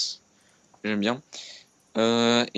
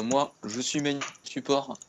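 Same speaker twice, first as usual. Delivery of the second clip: usual speaking voice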